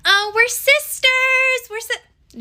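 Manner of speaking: very high, drawn-out gushing squeal; mock sorority squeal. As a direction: squeal it like an over-excited sorority sister. Mimicking gushing sorority sisters.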